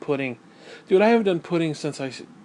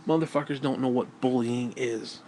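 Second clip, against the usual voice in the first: normal talking voice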